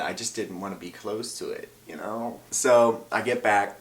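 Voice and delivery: normal talking voice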